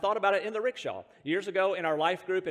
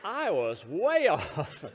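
laughing; 3 semitones lower, slower than usual. Real, not acted.

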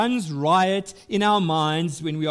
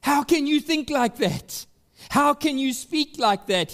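voicing Isaiah's rebuke; 10 semitones higher, 3 dB louder than usual. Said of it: "look at what Isaiah says".

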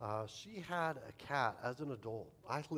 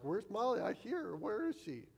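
mock frantic; 8 semitones higher than usual. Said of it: "frantically walk around".

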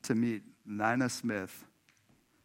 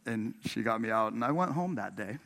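ordinary talk, nothing marked

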